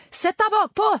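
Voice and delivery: high-pitched, hurried order; urgent shout. A narrator's reading. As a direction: call out to get him moving. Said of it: "ropte Angela Falk".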